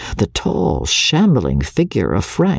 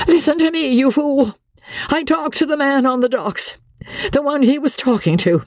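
high, quavering frantic voice; wife's high, quavering panic. Acted. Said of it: "Her voice was high and quavering".

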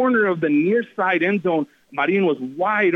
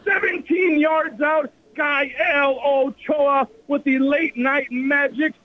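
thrilled; 11 semitones higher, slower than usual. Real, not acted. Announcer after the buzzer-beater.

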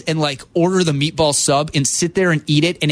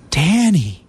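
laughing, higher coaxing imitation; J D's calming high voice; 2 semitones higher, slower than usual. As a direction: gently insist he come to dinner. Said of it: "that calming, high voice".

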